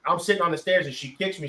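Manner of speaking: normal talking voice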